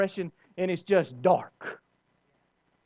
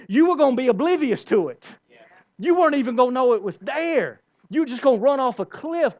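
loud; 7 semitones higher, 6 dB louder than usual. Acted. God voiced, raised intensity.

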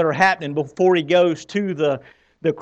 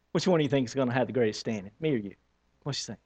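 very soft, fast, sly whisper-like aside; hushed scheming; 5 semitones lower, 10 dB quieter, much faster than usual. Retold or imitated. Disciple nudging a friend about rank, hushed and quick. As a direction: murmur it behind your hand, quickly.

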